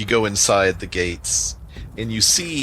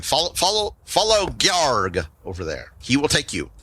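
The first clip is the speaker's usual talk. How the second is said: high-pitched goblin giving directions; goblin guide; 7 semitones higher than usual. Acted. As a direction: point the way in a raised goblin voice. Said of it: "You see Yarbnock kinda pointing".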